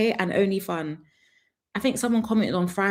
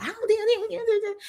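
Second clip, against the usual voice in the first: high, slow angry American voice; much slower than usual. Retold or imitated. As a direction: stretch it out, much higher, like an angry American. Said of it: "when Americans are angry".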